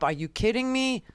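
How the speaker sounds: ordinary talk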